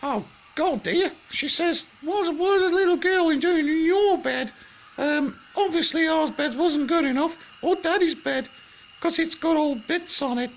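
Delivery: very high loud broad Australian mum voice; an outraged Aussie mother bear; Australian woman. Acted. Mummy Bear fumes about the girl in the bed, shrieking Australian mum. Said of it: "all Australian women talk like that".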